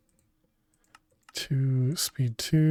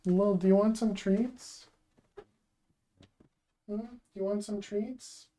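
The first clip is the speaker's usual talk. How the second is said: pet talk; 7 semitones higher, 8 dB quieter than usual. Real, not acted. Talking sweetly to the cat.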